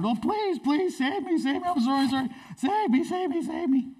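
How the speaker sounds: very high, whimpering plea; desperate begging. Acted. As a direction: beg frantically in a hammed-up squeal. Haman begging Esther for his life.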